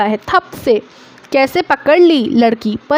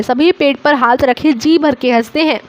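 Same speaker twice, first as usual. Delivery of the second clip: plain reading voice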